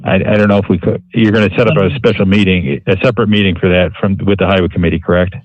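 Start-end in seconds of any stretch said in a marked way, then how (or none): none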